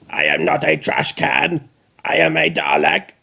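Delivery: mechanical robot voice; lightly voiced grating machine voice; Dalek voice. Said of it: "in his gravelly mechanical voice".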